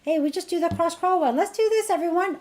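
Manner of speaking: put-on upbeat game leader calling kids; high playful rally. Shown as a demonstration. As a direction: get the kids moving with a cheery call. Redirecting squabbling kids to play.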